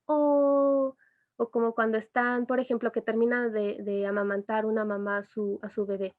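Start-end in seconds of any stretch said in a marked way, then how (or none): 0.1-1.0 put-on baby coo sound. Shown as a demonstration. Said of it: "o entonces la".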